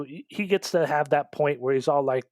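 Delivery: ordinary talk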